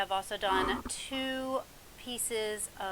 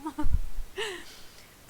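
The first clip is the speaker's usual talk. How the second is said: bursting laugh; 8 semitones higher, 11 dB louder than usual. Real, not acted. Laughs at own slip.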